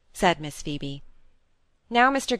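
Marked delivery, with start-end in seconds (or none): none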